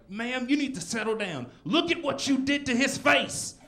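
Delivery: high, loud mock cop; a hammed-up officer scolding her; cop telling her off. Police officer scolding the girlfriend, loud and high. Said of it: "they're putting her in handcuffs, like".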